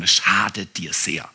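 slow whisper, no voice; soft, slow whispered caution; whispered warning. Acted. Gentle warning inside the sermon.

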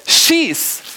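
sudden high, loud bark of an order; "Schieß!" blurted high and loud; shouted command. Acted. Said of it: "und schließlich sagte er".

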